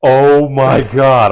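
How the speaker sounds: slow, loud drawn-out oh my God; a hint of a horrified clerk; mortified salesman. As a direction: salesman realizing his mistake, slow and loud.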